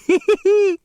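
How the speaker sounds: high cackle. A narrator's reading. Otis cackling at the startled runner, high and loud. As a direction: cackle like a gleeful old delivery boy. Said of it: "he cackled".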